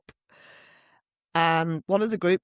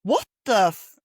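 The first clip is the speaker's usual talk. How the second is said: loud, incredulous outburst, rising sharply in pitch; retold bewilderment; 8 semitones higher, 7 dB louder than usual. Retelling her reaction to a man's abuse, incredulous. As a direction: act out stunned disbelief, louder and higher.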